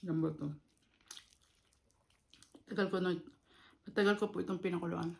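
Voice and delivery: talking between bites; eating while speaking; mouth full, chewing. Real, not acted. Seen 1: Closed lips and full cheeks between words; eats meat throughout.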